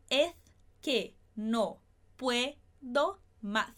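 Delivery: very slow, every s said as th; ceceo lisp. Shown as a demonstration. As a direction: draw out es que no puedo más lisping each s. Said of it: "esse som de colocar a língua entre os dentes".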